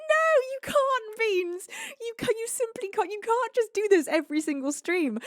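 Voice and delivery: hammed-up tearful begging; mock wailing plea. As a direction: whimper the protest like a spoiled child. Pleading with chat over hype train.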